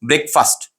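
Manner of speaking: "ब्रेकफास्ट" run together as BREK-fust; short vowels, no split in "ब्रेकफास्ट"; said as one word. Shown as a demonstration. Teacher models it for students, "ब्रेकफास्ट" as one quick word. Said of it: "एक ही साथ में pronounce करना है".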